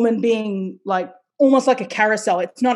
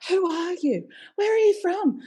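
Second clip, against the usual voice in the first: mock surprised colleagues; 9 semitones higher than usual. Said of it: "they were like".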